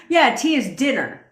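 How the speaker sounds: mock British accent. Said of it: "trying to do your accent".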